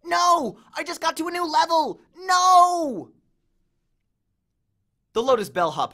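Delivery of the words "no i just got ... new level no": much higher, louder protest; frantic shout. A narrator's reading. Grover protesting as friends drag him from the game. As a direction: wail like a kid dragged away. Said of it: "as he shouted".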